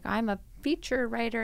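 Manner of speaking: a hint of a 1940s Mid-Atlantic film accent; offended accent. Guest mocking her own offended pride in a light film accent. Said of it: "I don't know what accent that was".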